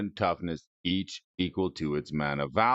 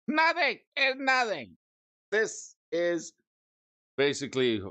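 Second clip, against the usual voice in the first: mock high squeal; 10 semitones higher than usual.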